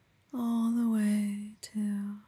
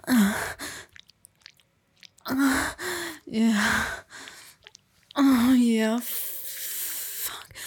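moaning; 4 dB louder than usual. Acted. Climaxing during sex.

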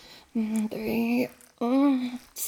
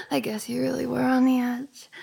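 ordinary talk, nothing marked

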